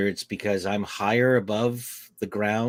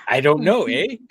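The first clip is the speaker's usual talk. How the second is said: mock Canadian accent; 6 semitones higher, 9 dB louder than usual. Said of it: "And I go".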